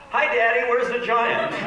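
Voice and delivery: put-on high, loud kid greeting; eager little girl. Daughters greeting daddy at the airport, high and loud. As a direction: call out like an excited toddler off the plane. Kids arriving, asking for giant.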